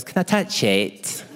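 slow, put-on nurse voice; mock-delicate nurse question; imitated set nurse. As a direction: ask gingerly, drawing it out. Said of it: "she goes".

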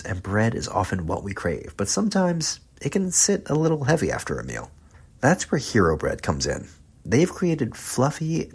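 friendly, lightly upbeat sponsor read. Acted. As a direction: sell the bread with a light, casual pitch. Host reads sponsor ad.

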